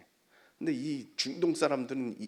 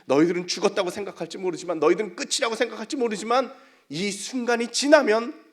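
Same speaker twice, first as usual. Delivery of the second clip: louder, building proclamation; rousing preaching; 8 semitones higher, 11 dB louder than usual. Real, not acted. Preacher promises life after this moment, rousing preaching. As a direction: build steadily toward a climax.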